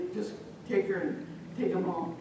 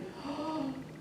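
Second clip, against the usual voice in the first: soft, high sucked-in breath; frightened gasp; 8 semitones higher, 9 dB quieter than usual. Acted. Little girl coming in, frightened gasp. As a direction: gasp before crying out.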